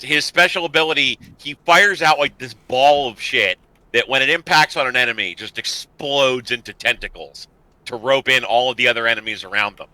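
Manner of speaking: normal talking voice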